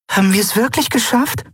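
high voiced careful question; cautious Nelen. A narrator's reading. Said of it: "fragte Nelen vorsichtig".

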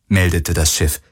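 ordinary delivery, nothing marked

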